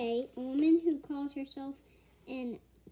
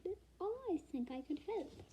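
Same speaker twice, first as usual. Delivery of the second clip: bright; 5 semitones higher, 7 dB quieter than usual. A narrator's reading. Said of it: "she said brightly".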